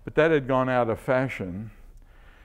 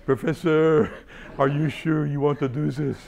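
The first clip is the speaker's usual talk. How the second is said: raised, loud French-accented imitation; Fouget's French accent; 3 semitones higher, 6 dB louder than usual. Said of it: "he had a wonderful French accent".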